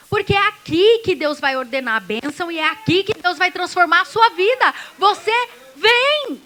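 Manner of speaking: high, loud preaching push; fiery preaching. Acted. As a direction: drive it home to the congregation. Preaching at full force.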